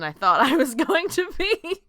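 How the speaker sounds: real laugh rising high and loud; laughing while talking. Real, not acted. Player admits to being overwhelmed, laughing through the words. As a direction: let laughter break into the words.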